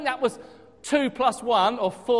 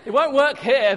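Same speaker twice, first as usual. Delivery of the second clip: mock Teesside accent; 5 semitones higher, 9 dB louder than usual. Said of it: "they say".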